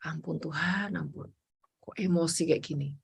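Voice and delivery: low, soft, almost breathed plea for mercy; quiet repentant prayer. Retold or imitated. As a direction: murmur for forgiveness alone in the car. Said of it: "Dalam perjalanan mobil saya pemberesan sama Tuhan".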